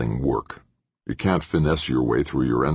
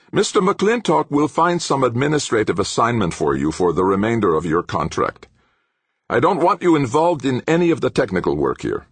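high-pitched, forceful professor; angry command; 10 semitones higher, 4 dB louder than usual. A narrator's reading. Professor barring the engineer from technical work, angrily commanding. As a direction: lay down orders in anger.

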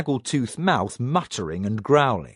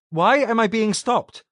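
higher, a hint of nervous protest; nervous young man; 8 semitones higher, 3 dB louder than usual. A narrator's reading. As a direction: plead lightly as the wary intruder. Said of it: "Robert said".

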